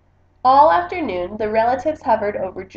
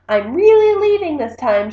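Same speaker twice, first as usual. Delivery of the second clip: raised, calling voice; a loud call as she runs off; 5 semitones higher, 6 dB louder than usual. A narrator's reading. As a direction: call it out so they hear. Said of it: "she called".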